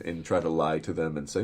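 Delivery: ordinary talk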